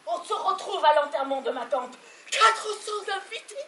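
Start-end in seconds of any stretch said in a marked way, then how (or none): 2.3-3.6 high, agitated outcry; frantic protest. Acted. Wife counts what the wedding would waste, frantic. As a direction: pitch it high in rising panic.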